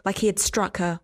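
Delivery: plain reading voice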